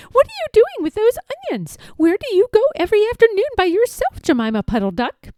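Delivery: suspicious collie dog. A narrator's reading. Collie Kep questioning Jemima about the onions, high and quick.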